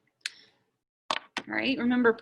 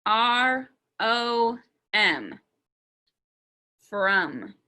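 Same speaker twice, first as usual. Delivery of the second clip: slow, letter by letter; spelling out; much slower than usual. Shown as a demonstration. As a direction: spell it out slowly with gaps between letters. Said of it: "I'm gonna sound it out".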